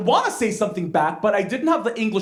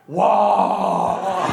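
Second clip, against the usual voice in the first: long drawn-out "wow" in unison style; awed mom's friends; 3 semitones lower than usual. Retold or imitated. Mom's friends watch the boy's recital, mock awe. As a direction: imitate an impressed audience, stretched out. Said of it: "My mom's friends".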